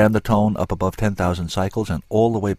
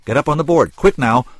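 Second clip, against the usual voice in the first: loud, higher, hurried command; urgent order; 7 semitones higher, 8 dB louder than usual. A narrator's reading. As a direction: hurry her up onto the board. Scientist orders her onto board.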